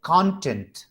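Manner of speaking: "Content" said CON-tent; stress on first syllable. Shown as a demonstration. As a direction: punch the opening of "content", let tent drop. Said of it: "वो कॉन पे आ रहा है ज्यादा".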